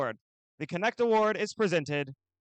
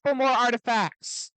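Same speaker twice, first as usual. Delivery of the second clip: put-on broad singsong kids theatre. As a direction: pump up the crowd like an emcee. Event announcer hyping the crowd.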